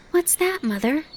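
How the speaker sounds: put-on high, eager child asking; excited child question. Fawn asking his mother, high and eager. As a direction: ask like a wide-eyed fawn. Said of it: "he asked".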